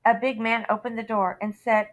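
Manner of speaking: ordinary delivery, nothing marked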